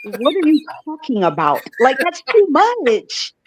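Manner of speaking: playfully object, pitch climbing, drawing out the end; mock protest. Voicing the friend's reaction to slang, mock baffled protest. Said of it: "You're like".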